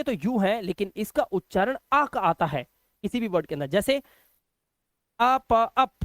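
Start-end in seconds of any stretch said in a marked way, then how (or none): 5.2-5.9 "अप" broken into a and pa, then joined, loud; sounding out. Shown as a demonstration. Teacher showing learners the U sound in "अप", sounding out. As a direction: say "a", "pa", then blend to "अप". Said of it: "इसे हमने ऐसे पढ़ा अप".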